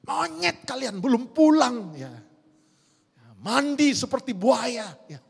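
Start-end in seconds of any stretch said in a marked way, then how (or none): 0.0-1.9 high mock scolding, swelling loud; imitating scolding grandmother. Grandmother scolds grandchildren not yet home, mock angry yell. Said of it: "aduh, mulutnya". 3.4-5.0 raised, drawn-out mock scolding; imitating scolding grandmother. Grandmother scolds grandchildren over bathing, slow mock scolding. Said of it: "aduh, mulutnya".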